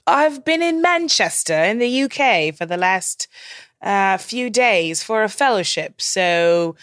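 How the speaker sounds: hammed-up fake British accent; bad British accent. Guest back from Manchester, joking in a bad British accent. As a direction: put on a terrible English accent. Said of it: "I should probably stop my terrible accent now".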